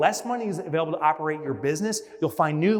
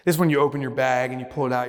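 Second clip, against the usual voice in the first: ordinary delivery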